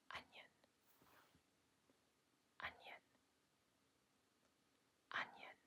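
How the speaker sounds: soft whispered test word. Pretend. Examiner starts a little further back, whispering. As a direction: whisper the word for a hearing check. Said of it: "I'm gonna whisper a set of words".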